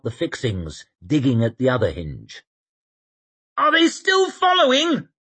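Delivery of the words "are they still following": calling up. Acted. Called from below the roof.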